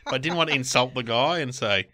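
ordinary delivery

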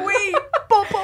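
high squeal through a laugh. Real, not acted. Friend teasing with a nickname, laughing high. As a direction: blurt the nickname while cracking up.

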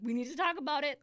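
panicked begging. Retold or imitated. Begging boyfriend to wait before the needle, panicked. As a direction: beg him to stop and talk first.

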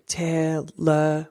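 "television" broken into four beats, "te-le-vi-sion"; tapped syllables. Shown as a demonstration. Parent drumming syllables for a child, "television" beat by beat. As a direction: tap a drum on each part of "television". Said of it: "let's tap the drums while we say".

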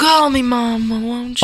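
melodramatic Southern mother, begging; Southern accent. Acted. Mother begs son to let her back, Southern accent. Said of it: "do this in a Southern accent".

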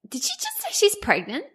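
high, disbelieving inner monologue; stunned inner voice. Retold or imitated. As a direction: replay the shock in your head. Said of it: "everything that was going on in my brain".

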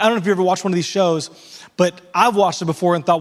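usual speaking voice

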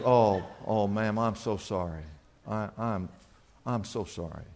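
low, very soft acted apology; imitating a gentle, sorrowful store owner; hushed condolence. Store owner consoles bereaved customer.

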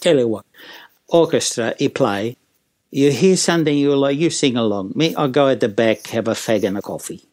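grumpy mock Italian accent; offhand, weary Italian-accented delivery. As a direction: hand over the show in broken Italian-accented English. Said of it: "did the whole routine again and says".

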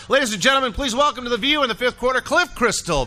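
high, ringing formal guest introduction; announcer. Acted. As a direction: bring the guest on like a ring announcer.

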